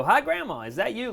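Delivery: high, loud teasing holler; excited call. Retold or imitated. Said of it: "we always yell".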